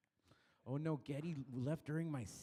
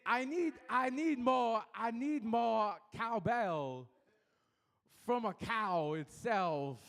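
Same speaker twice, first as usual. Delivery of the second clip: slow, higher, louder halting Walken cadence; Christopher Walken cowbell; 6 semitones higher, 4 dB louder, slower than usual. Acted. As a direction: drag out the cowbell line like Walken, pitched up.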